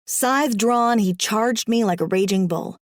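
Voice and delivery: plain reading voice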